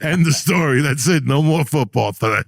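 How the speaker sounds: yelling to stop the game; mock shout. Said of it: "and we're like".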